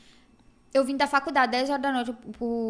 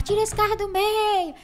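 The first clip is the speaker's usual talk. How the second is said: mock yell; 9 semitones higher, 4 dB louder, slower than usual. Drivers yelling at stalled car.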